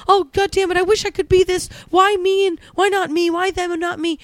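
mock the envious complainer; hammed-up jealous moan; jealous whining. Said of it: "Not going".

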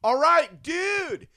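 very high, loud, drawn-out yell; over-the-top holler over a crowd; mock audition yelling. Auditioning actor yells his line at casting, hammed-up shout. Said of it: "after having people yell at you".

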